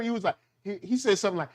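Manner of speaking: normal talking voice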